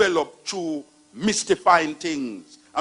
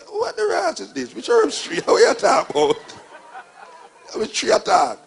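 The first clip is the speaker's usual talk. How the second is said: mock disbelief; 9 semitones higher, 6 dB louder than usual. Mock reply to Rasta claim.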